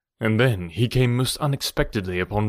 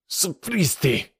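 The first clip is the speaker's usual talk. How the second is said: mild mock scream; 7 semitones higher, slower than usual. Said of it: "screamed the infuriated Rokoff".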